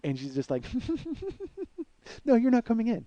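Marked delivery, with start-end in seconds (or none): 0.7-1.8 high-pitched imitated weird titter; mock a maniacal little giggle. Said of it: "tittering giggle". 2.2-3.0 high, loud mocking refusal; giggly girlfriend imitation. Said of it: "she had this weird giggle".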